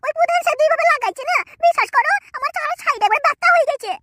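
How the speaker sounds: angry wife. Acted. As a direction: nag the husband about his friend. Wife answers husband.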